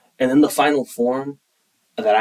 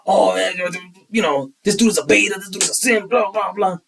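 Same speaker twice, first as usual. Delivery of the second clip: higher-pitched imitation of someone calling men weak; mock name-calling; 5 semitones higher than usual. Podcaster paraphrasing a streamer who calls people weak, mocking. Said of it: "I'm paraphrasing here, but he was, like".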